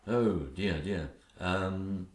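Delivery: a soft, low sinking oh dear; husband cornered by his wife, softly dismayed. Acted. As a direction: deflate under the pressure.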